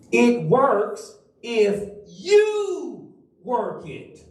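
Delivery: you drawn out, phrase slowed; stress on YOU, slow. Shown as a demonstration. Pastor aims the motto at D, stressing you. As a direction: linger and press on you. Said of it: "I think this one is gonna be yours".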